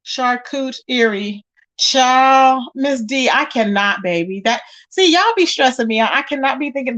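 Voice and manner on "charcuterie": slow, sounded-out "Charcuterie"; careful charcuterie. Shown as a demonstration. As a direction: struggle through "Charcuterie" syllable by syllable. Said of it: "that word is too much".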